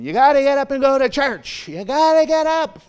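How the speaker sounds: high, loud nagging mom; mock mom yelling to get up; mother nagging. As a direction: urge a kid out of bed for church. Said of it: "the mother just saying".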